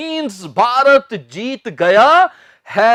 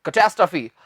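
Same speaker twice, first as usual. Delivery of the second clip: Catastrophe run together, lower, no break; unbroken "kuh-TAS-truh-fee" for catastrophe; joined as one word; 5 semitones lower, 4 dB quieter, much faster than usual. Shown as a demonstration. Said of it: "एक साथ बोलना है आपने".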